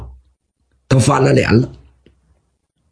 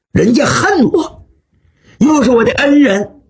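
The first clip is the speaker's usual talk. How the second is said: ordinary delivery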